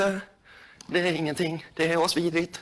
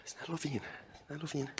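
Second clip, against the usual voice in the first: hushed coaxing; 5 semitones lower, 18 dB quieter than usual. Real, not acted. Soothing the snake, hushed.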